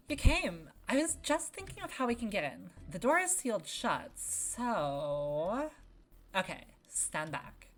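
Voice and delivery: high, loud, bubbly explosion enthusiast; gleeful high-energy character voice, stretching the big moments; excitable game character. Acted. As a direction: perform an over-excited cartoon friend, big swooping pitch.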